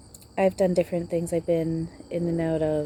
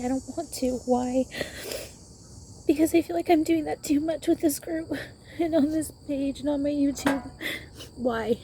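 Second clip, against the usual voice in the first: much higher, tearful crying voice; 9 semitones higher, 4 dB louder than usual. Real, not acted. Admitting she's negative in the group, crying. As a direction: push the words out through real tears. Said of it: "Because I'm crying".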